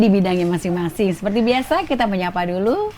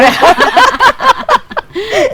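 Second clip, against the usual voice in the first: high, loud peal of laughter; real uproarious cackle; 11 dB louder than usual. Real, not acted. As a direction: burst out laughing at the joke. Laughs at body odour joke.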